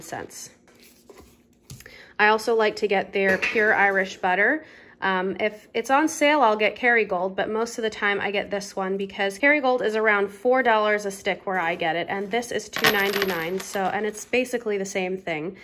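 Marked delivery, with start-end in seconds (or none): none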